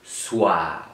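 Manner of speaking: "soir" stretched slowly, falling in pitch; drawn-out syllable. Shown as a demonstration. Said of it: "On entend wa dans". (« soir » prononcé bas, en allongeant le son wa)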